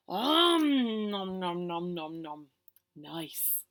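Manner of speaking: fading greedy om nom nom; munch it, then sigh nice; munching noises. Acted. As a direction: nom noises trailing to a whisper. Eating cake again.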